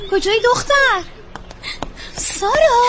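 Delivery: high-pitched calling out; searching call. Acted. Searcher calls after the missing girl, high-pitched. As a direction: call for her to show herself.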